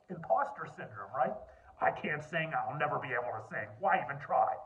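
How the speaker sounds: ordinary talk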